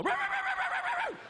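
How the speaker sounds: high yappy puppy barks; puppy barking. Retold or imitated. Comic imitating a puppy sneaking up, high yapping barks. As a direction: yap like a little cartoon puppy. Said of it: "sneak up behind and go".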